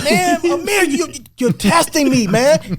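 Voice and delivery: exasperated complaint. Retold or imitated. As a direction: tell him he's testing you. Retold heated argument.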